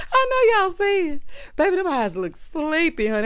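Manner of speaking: high, loud, still laughing; giggly teasing; laughing while talking. Real, not acted. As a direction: talk through the laugh. Laughing at his sleepy eyes.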